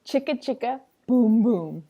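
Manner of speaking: usual speaking voice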